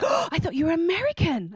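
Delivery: gasped, high-pitched astonishment; mock surprise. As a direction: imitate someone taken aback by the news. People surprised she is Irish.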